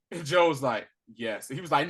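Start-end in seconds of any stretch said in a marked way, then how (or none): 1.1-1.5 soft, low unconvincing yes; a half-hearted, mumbled agreement. Retold or imitated. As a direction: imitate someone agreeing without meaning it. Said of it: "you don't sound convincing".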